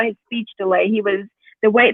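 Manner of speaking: usual speaking voice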